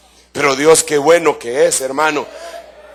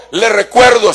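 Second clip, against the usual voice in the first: ordinary talk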